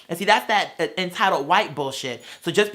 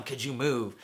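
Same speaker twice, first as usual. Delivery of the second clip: low, much softer mock of the customer; mocking her quietly; 7 semitones lower, 10 dB quieter, slower than usual. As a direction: drop low and soft to mimic her. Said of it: "Up here talking about some".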